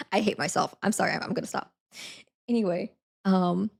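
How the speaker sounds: ordinary delivery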